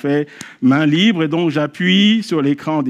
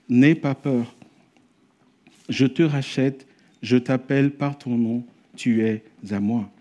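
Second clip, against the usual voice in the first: tender reading; 4 semitones lower, 3 dB quieter, slower than usual. Acted.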